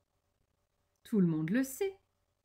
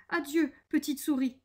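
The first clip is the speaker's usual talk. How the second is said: higher-pitched farewell, mildly alarmed; alarmed exclamation; 7 semitones higher than usual. A narrator's reading. The owl flees to its tree, exclaiming a farewell. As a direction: call a quick acted goodbye. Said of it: "s'exclama-t-il".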